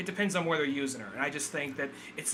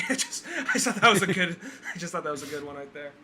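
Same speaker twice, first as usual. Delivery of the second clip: laughing; 2 semitones higher, faster than usual. Real, not acted. Laughing at the joke.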